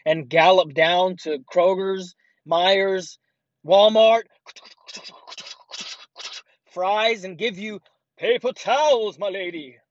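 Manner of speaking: high, grand heroic send-up; hammed-up chivalrous herald; mock gallant knight. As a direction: proclaim it like a knight to a lady. Mock knight delivering paper towels.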